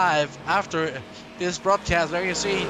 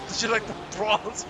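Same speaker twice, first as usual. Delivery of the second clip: mock Australian accent; 7 semitones higher than usual. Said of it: "That's Australian".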